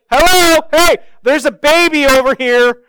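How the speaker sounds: wife shouting for help, hammed-up; calling out. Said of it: "Christy's just like".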